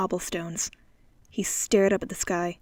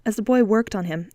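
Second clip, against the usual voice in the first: ordinary delivery, nothing marked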